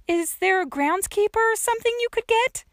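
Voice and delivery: high-pitched, hopeful asking; young girl pleading. A narrator's reading. Trapped girl asks old woman for a groundskeeper, high pleading. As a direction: call up a request for help.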